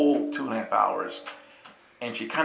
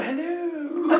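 much higher, dreamy, laughing hello; spacey hello. Shown as a demonstration. Preacher hamming up a spacey greeting, high and dreamy. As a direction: sing it out airy and far away. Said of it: "That was me being spacey too".

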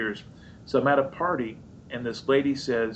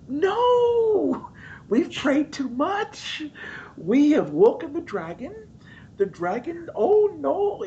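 high, panicky squeal of alarm; hammed-up frightened wail about the dragon; comic high-pitched terror; mock panic; slower than usual. Panicked reaction in church story.

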